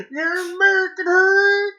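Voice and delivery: loud, high hillbilly holler. Acted. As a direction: holler it like a backwoods hillbilly Hagrid. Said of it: "redneck hillbilly".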